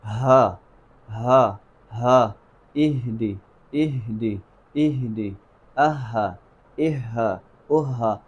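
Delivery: "ہا" lightly breathed, low in pitch, without effort; delicate haa. Shown as a demonstration. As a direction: let the h of "ہا" flow gently, each take spaced. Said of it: "بالکل اسے لطافت کے ساتھ پڑھنا ہے".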